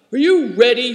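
loud, high demand; shouted. A narrator's reading. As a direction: bark it like a wild rider. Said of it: "he shouted".

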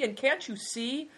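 high, indignant cry; high-pitched annoyed question; angry shout. A narrator's reading. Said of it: "he shouted angrily".

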